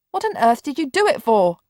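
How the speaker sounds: put-on a bedridden boy crying out at his sister; cried out. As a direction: throw the question out in alarm. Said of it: "Mark cried out".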